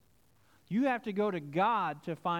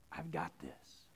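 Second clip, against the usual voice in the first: much lower and softer, calm and certain; low murmured Jesus taking control; quiet reassurance; 11 semitones lower, 10 dB quieter, slower than usual. Retold or imitated. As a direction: hush it like a promise. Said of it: "But Jesus is saying".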